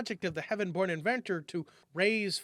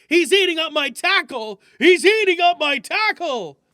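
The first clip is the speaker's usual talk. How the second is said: panicked cry; 9 semitones higher, 11 dB louder than usual. Acted. Jones panics as turkey eats line.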